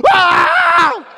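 very high, loud startled scream; retold shout of shock. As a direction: scream as the shoulder snaps back. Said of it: "I went".

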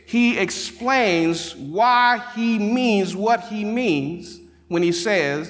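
high, loud, slow preacher's cadence; preaching at full force. Acted. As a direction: drive home the main point from the pulpit.

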